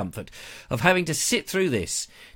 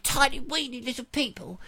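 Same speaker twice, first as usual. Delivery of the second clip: higher, cutesy little voice for tiny people; 9 semitones higher, 4 dB quieter than usual. Pretend. Describing tiny model people to viewers, cutesy higher voice. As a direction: make it sound sweet and tiny.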